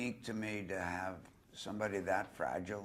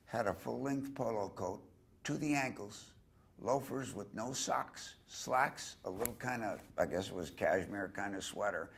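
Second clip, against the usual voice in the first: ordinary talk, nothing marked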